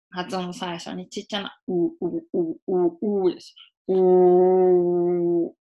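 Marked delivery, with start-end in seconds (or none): 1.9-5.5 "う" through puckered lips, last one held; rounded R onset. Shown as a demonstration. Teacher showing how R begins, puckered "う". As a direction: purse the lips tightly on "う". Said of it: "口をすぼめて".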